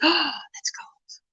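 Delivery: a gasp, then a soft breathy "that's cold"; gasped whisper. Real, not acted. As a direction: gasp as if the number chills you. Reacts to cold temperature.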